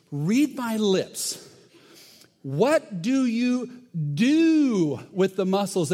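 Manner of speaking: slow, high, word-by-word demand; acted exasperation. Host asking muscle guys yet another time, mock exasperation. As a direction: spell it out slowly for someone not getting it.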